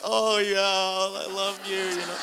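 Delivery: high, slow, sugary acceptance with a laugh; gushing accepting parent. Retold or imitated. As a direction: gush it like an overjoyed parent. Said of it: "and they're like".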